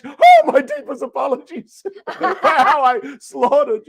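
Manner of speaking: loud, high, slow apology breaking into laughter; laughing apology. Real, not acted. Host apologizing to Irish and Scottish listeners, laughing loudly. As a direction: cry out an over-the-top apology through laughs. Mock apology for bad accents.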